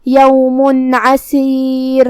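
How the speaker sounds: یوم عسیر with the nun clear, no nasal hum, عسیر very drawn out; tajweed demo. Shown as a demonstration. Teacher demonstrating izhar to her students, slow clear demo. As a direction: sound the tanween plainly and stretch عسیر. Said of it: "بغیر غنہ سے یہ حکم کو ادا کریں گے".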